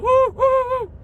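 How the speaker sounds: high shouted sarcastic woo; mock cheer. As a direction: cheer it out mockingly. Mocking cheer at being rich.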